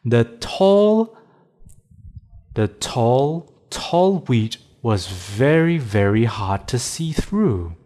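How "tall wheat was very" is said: ordinary delivery, nothing marked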